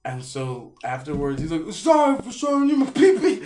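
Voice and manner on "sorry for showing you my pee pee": high-pitched caricature of a sheepish apology; mimicked apology. Acting out Peter's apology, then laughing.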